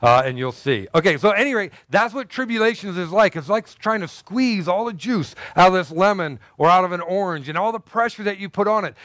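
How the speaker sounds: usual speaking voice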